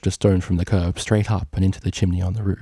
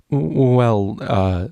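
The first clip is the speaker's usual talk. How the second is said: a frightened stallion fumbling; stammering; 4 semitones higher, much slower than usual. Acted. Stallion stammering before his angry boss. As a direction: stutter nervously.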